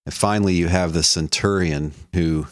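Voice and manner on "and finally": normal talking voice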